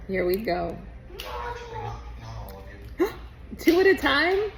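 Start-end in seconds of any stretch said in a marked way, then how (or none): 0.8-3.5 sharp gasp of surprise; surprised gasp. Real, not acted. Kid eats two sour candies. 3.6-4.5 higher, louder surprised question; shocked he took two. Real, not acted. Kid eats two sour candies.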